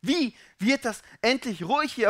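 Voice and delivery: loud, high, exasperated pleading; fed up and urgent, building in force; desperate questioning. Acted. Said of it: "fragst dich".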